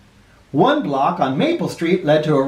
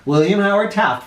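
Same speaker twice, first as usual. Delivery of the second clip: usual speaking voice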